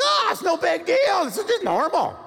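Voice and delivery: loud, high-pitched comic brush-off; mock dismissive shout. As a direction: shout it off, hammed up, as no matter. Acts couple brushing off their quarrel.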